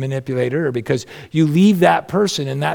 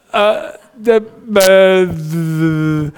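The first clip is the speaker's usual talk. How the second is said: flustered stammer; 3 semitones higher, 3 dB louder, much slower than usual. Retold or imitated. Caught out by his wife.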